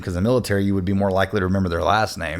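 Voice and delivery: usual speaking voice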